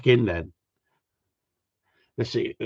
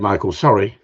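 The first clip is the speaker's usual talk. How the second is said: normal talking voice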